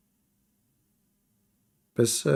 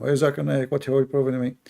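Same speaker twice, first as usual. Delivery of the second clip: usual speaking voice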